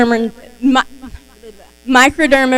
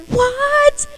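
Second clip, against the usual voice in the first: a very high, drawn-out What; stunned disbelief. Retold or imitated. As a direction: squeak it in utter disbelief. Acting the stunned winner.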